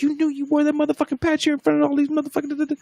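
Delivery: high, fast tearful accusation, lightly done; upset accusation. Retold or imitated. Girl in tears accusing the dude, high fast mock anger. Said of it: "She goes".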